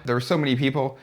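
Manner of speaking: ordinary delivery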